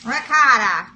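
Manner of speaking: loud New York ricotta, ricotta as rigotta; Queens accent. Shown as a demonstration. Vlogger repeats her Queens ricotta, loud. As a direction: belt ricotta like a Queens native. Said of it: "I can't say it, guys".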